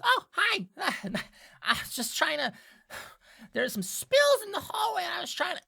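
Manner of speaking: high, nervous imp making excuses; flustered little imp with an awkward laugh; Diplin caught out. Acted. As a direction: stammer an excuse in a squeaky high voice. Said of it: "Diplin goes".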